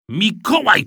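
high, exasperated call; shouted name. A narrator's reading. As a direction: cry out the boy's name in annoyance. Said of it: "krzyknął tata". (krzyk zdenerwowanego taty)

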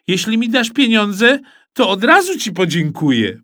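put-on boy answers dad, high and cheeky; cheeky kid. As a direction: try it on with dad. Cheeky reply to father.

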